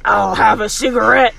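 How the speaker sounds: loud put-on smoker character voice. Shown as a demonstration. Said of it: "and a voice".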